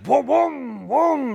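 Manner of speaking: high, loud imitated engine booms. A driver mimicking the rival's engine roaring beside him, loud booms. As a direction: bark out each boom like an engine firing.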